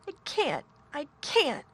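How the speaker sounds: high, gasping sobs; sobbing. A narrator's reading. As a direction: plead through tears. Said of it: "he gasped and sobbed".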